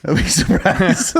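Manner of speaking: laughs the words out slowly; cracking up while repeating it; laughing. Real, not acted. Repeating his friend's joke through laughter.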